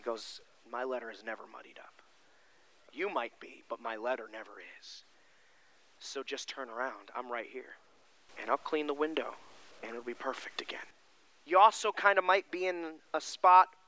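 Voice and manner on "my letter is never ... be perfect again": hushed, tender whispered assurance; soft whisper. Acted. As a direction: lean in and whisper it gently. Jesus speaking softly to sinner.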